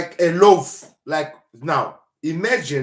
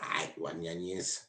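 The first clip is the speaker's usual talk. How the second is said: mock crowd; 9 semitones lower, 12 dB quieter than usual. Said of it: "people eventually were like".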